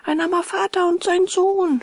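put-on high, eager little boy's voice; a small boy offering help, pitched high; high boyish character voice; voiced puppet boy. A narrator's reading. Puppet boy answers at door.

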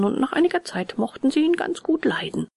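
ordinary delivery

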